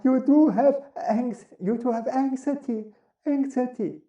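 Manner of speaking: mild mock anxious voice; anxious throat voice. Shown as a demonstration. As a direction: sound a little nervous and pinched. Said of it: "if you speak out of your throat".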